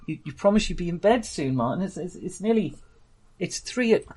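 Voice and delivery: high, quick New York nagging; New York accent. Acted. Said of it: "a New York".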